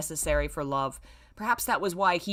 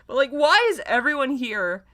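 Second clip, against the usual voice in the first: exasperated rant; 4 semitones higher, 6 dB louder than usual. Real, not acted. Ranting at the story.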